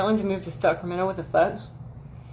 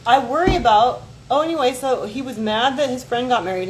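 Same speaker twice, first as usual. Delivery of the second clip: normal talking voice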